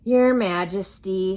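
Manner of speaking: loud, slow formal soldier; soldier's address. Acted. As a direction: announce it with a stiff bow.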